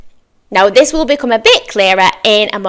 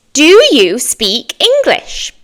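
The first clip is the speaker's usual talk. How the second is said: slow model question; 4 semitones higher, slower than usual. Shown as a demonstration. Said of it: "I'm gonna say that one more time".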